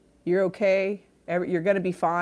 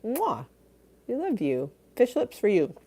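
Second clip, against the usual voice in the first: high playful kiss sound; blowing a kiss; 7 semitones higher than usual. Real, not acted. Streamer blowing a kiss to Andy, high and playful. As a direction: send a smooch to the chat.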